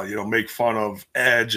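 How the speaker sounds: normal talking voice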